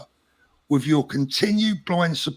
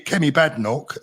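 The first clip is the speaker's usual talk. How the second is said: ordinary talk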